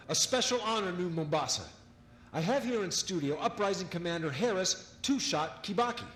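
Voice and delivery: cheerful broadcaster introducing a guest; radio host. Acted. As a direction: sell it like a live broadcast host. Radio host announcing on air.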